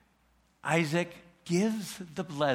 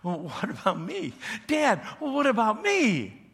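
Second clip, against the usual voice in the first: bitter plea; 6 semitones higher than usual. Retold or imitated. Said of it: "let out a bitter cry".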